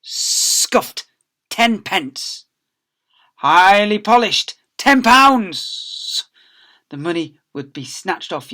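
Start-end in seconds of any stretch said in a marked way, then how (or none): 0.0-6.2 clipped judge of shoes, louder and higher on the big fine; a hint of a mean old librarian; greedy librarian. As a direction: price up each child. Librarian judging shoes for fines.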